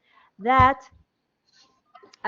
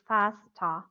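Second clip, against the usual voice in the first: lower, softer British "faster" with a long ah; British English pronunciation; 5 semitones lower, 3 dB quieter than usual. Shown as a demonstration. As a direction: say "faster" as British "fahs-tah". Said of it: "according to British English".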